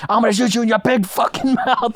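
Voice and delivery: high, hammed-up movie scream with a laugh; mock yell at a soldier, half laughing; imitated scream. Retelling Sizemore screaming at a soldier, mock yell. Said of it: "He's, he's screaming".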